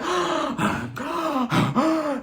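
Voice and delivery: imitated dramatic gasps; high, exaggerated gasps; gasping for air. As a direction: mimic someone gulping for breath. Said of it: "Lisa gasping for air".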